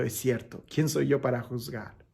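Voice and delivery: soft, low, imitating a humbled penitent; contrite apology. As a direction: quietly own up as someone devastated. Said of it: "pidiéndole perdón".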